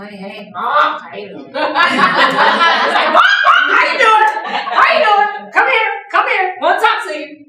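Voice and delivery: high, loud, excited chatter; chatty parrot voice. Acted. Trainer voicing the talkative parrot, high and excited. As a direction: squawk it out like an eager parrot. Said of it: "That's the parrot".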